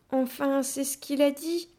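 sheepish. A narrator's reading. Child lowers their eyes after the silly accusation, sheepish. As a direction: back down in an embarrassed high voice. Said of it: "ajoutai-je en baissant les yeux".

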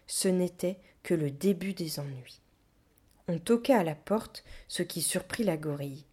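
plain reading voice

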